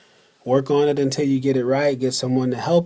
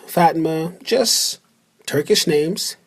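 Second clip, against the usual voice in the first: ordinary talk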